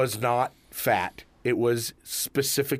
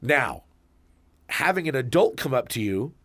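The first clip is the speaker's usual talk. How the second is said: normal talking voice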